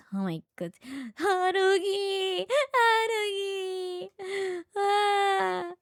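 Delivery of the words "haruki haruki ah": very high, long drawn-out wail; wailing cry. Acted. Calling out to Haruki, high drawn-out wailing. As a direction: wail the name, stretching the last vowel.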